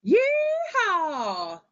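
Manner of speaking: high, loud cowboy whoop; excited yeehaw. Shown as a demonstration. Teacher demonstrating reading aloud, a loud cowboy yeehaw. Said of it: "they want you to say it loud".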